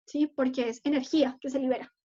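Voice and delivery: ordinary delivery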